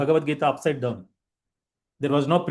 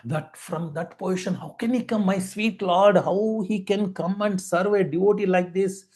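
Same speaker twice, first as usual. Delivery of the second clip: higher-pitched, moved devotee's awe; tearful wonder; 5 semitones higher than usual. Acted. Crying devotee marvels at his Lord serving a devotee, tearful wonder. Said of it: "I'm just shedding tears".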